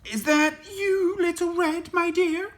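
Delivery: put-on high, loud old granny voice; Granny calling out. A narrator's reading. Granny calling through her door, high calling voice. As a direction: call out high as a storybook granny. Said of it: "called Granny".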